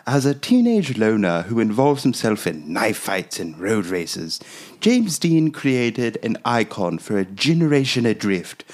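British man voice. Acted. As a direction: read it like a posh British critic, slowly. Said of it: "hit us with that British man voice, please".

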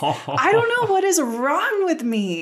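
high, plaintive self-mocking whine; exaggerated emotional wail. Real, not acted. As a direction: wail it in comic despair at yourself. Confessing tears over a cartoon.

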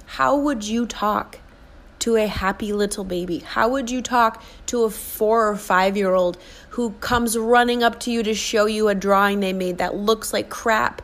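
usual speaking voice

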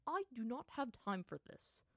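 plain reading voice

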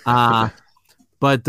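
ordinary delivery

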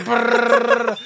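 loud, high rolled "brrr" drumming noise; imitated frantic bongos. Dad killing it on the bongos off beat, mock drum noise. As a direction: trill it like wildly off-beat drumming. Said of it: "He's just like".

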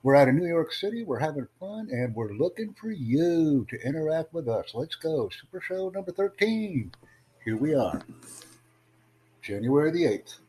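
high, lively livestream host intro; upbeat show opener. Real, not acted. Host welcoming viewers to Super Show number thirteen, upbeat announcer style. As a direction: kick off the show with big, swinging high pitch.

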